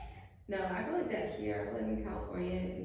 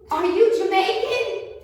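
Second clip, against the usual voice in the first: higher, louder and slowed, like a pushy stranger calling out; loud nosy stranger; 9 semitones higher, 11 dB louder, slower than usual. Retold or imitated. Stranger stops her in the crosswalk, loud pushy question. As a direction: belt it out, nosy and insistent.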